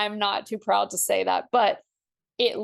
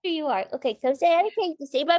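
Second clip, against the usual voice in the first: higher, fast cooing pet voice; 7 semitones higher, much faster than usual. Real, not acted. Presenting her puppy to Kate, fast high baby talk.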